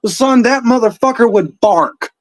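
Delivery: ordinary delivery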